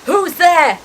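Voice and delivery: high, loud, alarmed shout; shouted challenge. A narrator's reading. Uncle Vernon to whoever is knocking, shouting. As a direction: call out loudly at an intruder. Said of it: "he shouted".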